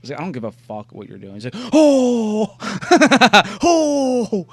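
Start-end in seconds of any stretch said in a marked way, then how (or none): 0.2-1.3 softer, mock-indifferent brush-off; playing it cool. Imitating a monkey watching the trick, playing it cool. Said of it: "trying to play it cool". 1.7-4.5 high, loud, drawn-out disbelief; mock astonishment. Imitating a monkey seeing the trick, astonished.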